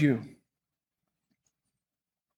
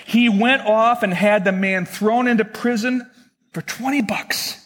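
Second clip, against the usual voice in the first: usual speaking voice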